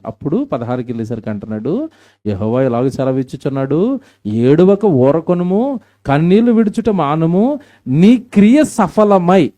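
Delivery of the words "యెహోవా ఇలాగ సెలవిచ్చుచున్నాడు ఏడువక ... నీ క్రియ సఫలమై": slow, loud and much higher; fervent scripture proclamation. Acted. Preacher urging weeping girls, slow and loud. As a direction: stretch the verse out as a loud cry. Reads verse sixteen aloud.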